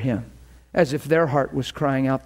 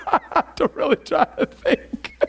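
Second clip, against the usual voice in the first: high-pitched words through real laughter; laughing while talking; 10 semitones higher than usual. Real, not acted. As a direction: talk through your own giggles. Laughing at own demonstration.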